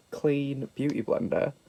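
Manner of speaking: ordinary delivery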